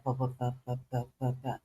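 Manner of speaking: low, quiet nonsense syllables imitating a velvety-voiced singer. Woman imitating spiritual men online, deep soul-singer voice.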